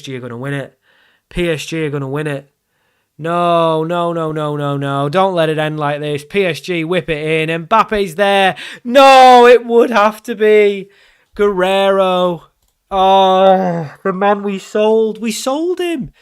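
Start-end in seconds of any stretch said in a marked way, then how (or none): 3.2-8.6 pleading dread building to a shout; mounting panic. Real, not acted. Dreading a late losing goal. 8.8-16.1 loud, high, drawn-out cries of despair; anguished outcry. Real, not acted. Ex-player scores the late winner.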